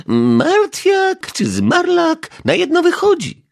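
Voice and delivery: put-on high, slow, firm old lady; firmly. A narrator's reading. Neighbour dismisses the detective's correction, high firm voice. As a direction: settle it flatly, no argument. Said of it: "powiedziała stanowczo".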